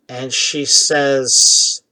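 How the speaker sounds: ordinary talk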